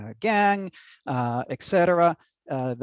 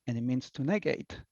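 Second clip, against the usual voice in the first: ordinary talk, nothing marked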